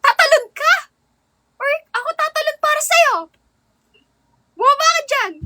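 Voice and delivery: high, loud sweet-angry scolding; mock angry Ilonggo. Streamer acts an angry Ilonggo scene, high and loud. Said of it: "gagawa ko ng scenario na parang galit ako".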